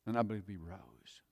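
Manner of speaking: ordinary talk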